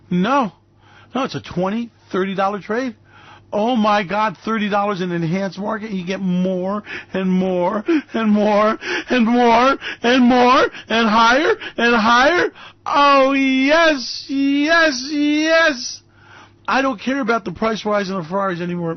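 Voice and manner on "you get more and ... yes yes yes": slow start building higher and louder; hammed-up rapture; building mock ecstasy.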